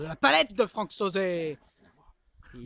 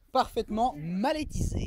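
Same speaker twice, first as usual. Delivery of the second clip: ordinary talk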